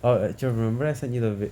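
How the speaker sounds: ordinary delivery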